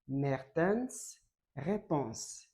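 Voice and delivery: slow, deliberate name. Shown as a demonstration. Teacher introduces his name to students, slow and deliberate. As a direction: slowly spell out the name Mertens Réponse. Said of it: "Prenez bien mon nom".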